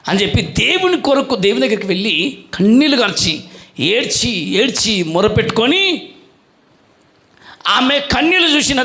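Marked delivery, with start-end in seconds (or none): none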